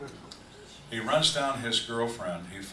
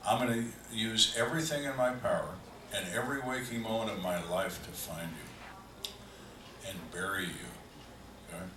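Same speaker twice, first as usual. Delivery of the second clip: ordinary talk, nothing marked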